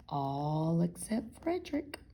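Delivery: ordinary delivery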